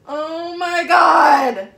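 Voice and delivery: long, high, loud cry of excitement; excited shout. Real, not acted. Opening a long-awaited guitar.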